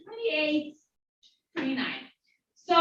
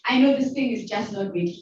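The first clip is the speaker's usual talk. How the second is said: ordinary talk, nothing marked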